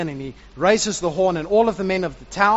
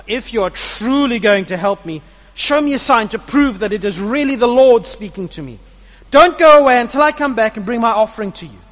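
louder, earnest pleading that builds; pleading Gideon; 4 semitones higher, 8 dB louder than usual. Acted. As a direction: beg urgently for a sign. Gideon begs for a sign.

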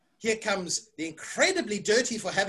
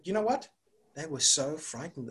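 ordinary delivery, nothing marked

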